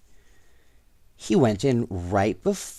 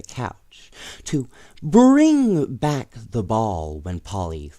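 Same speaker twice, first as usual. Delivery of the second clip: put-on lively read-aloud voice for children; storybook reading; story narration; 4 semitones lower than usual. A narrator's reading. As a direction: tell it like a bedtime storybook.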